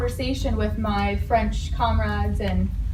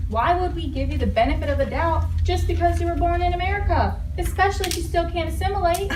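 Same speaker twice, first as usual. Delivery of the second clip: condescending; 7 semitones higher than usual. Acted. French character mocking, pitch up.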